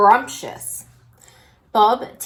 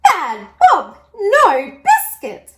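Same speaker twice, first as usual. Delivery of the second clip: much higher, louder and slow; high scolding gran; 8 semitones higher, 8 dB louder, slower than usual. A narrator's reading. Gran scolds Bob the dog, high and loud. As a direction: scold like a shocked granny.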